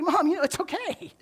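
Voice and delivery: high, loud reply to mom; loud mock reassurance. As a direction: raise your voice in amused exasperation. Answering mom's whispered confession.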